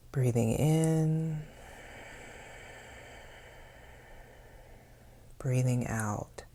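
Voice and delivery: unhurried meditation voice, long pauses between phrases; guided meditation. Acted. Host leading listeners to notice their breathing, guided meditation voice. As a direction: guide them calmly into the breath, leaving space after each phrase.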